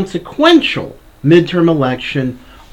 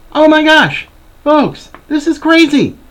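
much higher, louder disbelief; exasperated outburst; 12 semitones higher, 3 dB louder than usual. Real, not acted. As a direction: blurt it out in disbelief. Outraged reaction to the headline.

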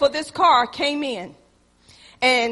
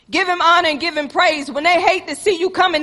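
usual speaking voice

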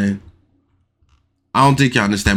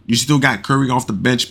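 usual speaking voice

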